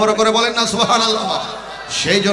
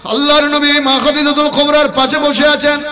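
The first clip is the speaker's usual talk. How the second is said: high, loud, drawn-out sermon chant; impassioned intoned storytelling; full-force preaching; 9 semitones higher, 7 dB louder than usual. Acted. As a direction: ring out each phrase slowly on a high note.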